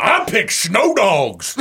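slow, as if chewing; mock coach, mouth full. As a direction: drawl it round a wad of chew. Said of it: "a bunch of chewing in his mouth".

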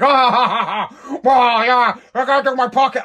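mock his podcast excuse with a chuckle; mocking laugh. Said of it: "And then they both laugh at me".

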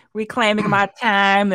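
mock Southern accent. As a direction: say "Reclaiming my time" in a mocking Southern accent. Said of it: "thinking about her saying".

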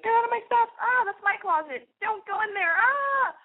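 very high, half-yelled bratty protest; hammed-up shout guarding your closet; mock territorial yell. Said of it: "I used to be like".

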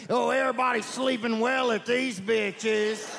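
high, slow put-on complaining voice; mock angry poster. An upset message board poster complains, in a slow, high mock whine. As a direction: whine like a disappointed forum user. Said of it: "people's all pissed off on the message boards".

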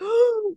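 sharp high gasp of delight; excited gasp. Retold or imitated. Actor hearing the pitch, delighted gasp. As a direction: gasp at a great idea. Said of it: "he was like".

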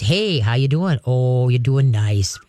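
low, easy greeting to a dog; very calm. Shown as a demonstration. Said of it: "You talk in a very calm".

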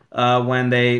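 usual speaking voice

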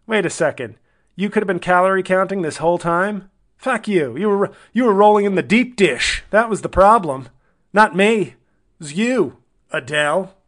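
fed-up Cockney ex, pitched high and loud; Cockney ex-husband. Acted. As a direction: protest indignantly in a put-on Cockney accent. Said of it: "doing, like, a cockneyed accent".

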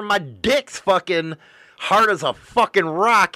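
normal talking voice

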